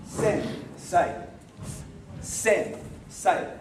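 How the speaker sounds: Sen, silence, then say; pause mid-word. Shown as a demonstration. Teacher showing the wrong way to say it, paused mid-word. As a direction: leave a clear gap between Sen and say. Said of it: "No pause".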